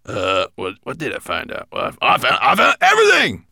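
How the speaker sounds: high, loud dwarf bursting with pride; proud dwarf. Acted. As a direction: shout everything in a high squeaky voice. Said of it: "replied Gravelbeard proudly".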